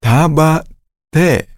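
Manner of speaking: ordinary talk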